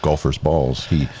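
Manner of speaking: low, hushed golf commentator. Acted. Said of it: "use your best golf voice".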